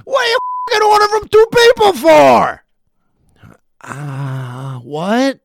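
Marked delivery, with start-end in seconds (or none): 0.0-2.6 very high, loud mock yell; hammed-up waiter rage; angry waiter. Teller reprising the waiter for the audience, high loud yell.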